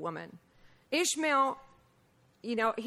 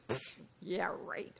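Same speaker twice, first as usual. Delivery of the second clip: scoffing; 4 semitones lower, 9 dB quieter, slower than usual. Retold or imitated. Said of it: "She goes".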